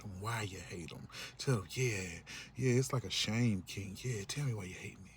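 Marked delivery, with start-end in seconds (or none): 1.7-5.1 mock masochist. Voicing someone enjoying being hated.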